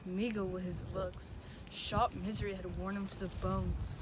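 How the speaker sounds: soft, brooding lines to himself; subdued soliloquy. Acted. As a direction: think aloud softly, with heavy pauses. Romeo's soliloquy alone, resolving to die.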